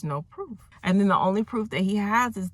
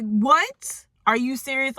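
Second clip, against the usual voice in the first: high, loud incredulous outburst; stunned disbelief; 8 semitones higher, 4 dB louder, slower than usual. Retold or imitated. Storyteller reacting to Elaine's calm answer, incredulous. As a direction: jump up in pitch, astonished. Teller reacts in shock to the reply.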